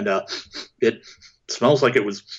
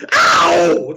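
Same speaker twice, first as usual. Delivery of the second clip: loud high yelp; sharp yelp of pain; 6 semitones higher, 8 dB louder than usual. Pretend. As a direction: yelp as it bites. Creature bites him.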